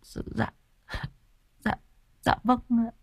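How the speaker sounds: tiny, high, faltering voice; very soft, halting and timid; hesitant stammering. Acted. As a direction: stammer a meek admission. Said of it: "ấp úng lắp bắp".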